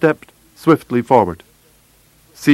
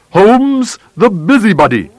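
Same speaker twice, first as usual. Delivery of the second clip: high-pitched, scornful outburst; angry taunt; 8 semitones higher, 3 dB louder than usual. A narrator's reading. Visitor taunting a smiling Holmes, high and scornful. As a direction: launch the name high as an insult.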